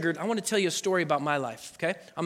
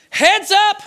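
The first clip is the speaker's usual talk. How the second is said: high, loud call of Heads up; shouted warning; 12 semitones higher, 9 dB louder than usual. Retold or imitated. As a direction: yell it across the field. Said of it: "and they say".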